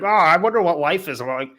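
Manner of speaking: hammed-up self-absorbed lament; mock complaining. Imitating the man who ignores the girl, mock complaining.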